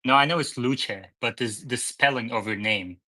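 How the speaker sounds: ordinary delivery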